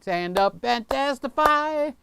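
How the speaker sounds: high, slow rallying call; mock preacher call. Quoting a viewer's comment as a rallying cry. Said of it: "Scotty says, "Don't sit down".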